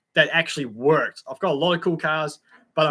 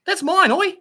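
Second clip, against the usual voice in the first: high, loud playful yelp; jokingly object, voice jumping up; mock protest; 6 dB louder than usual. Streamer ribbing a friend over an extension lead, mock outraged.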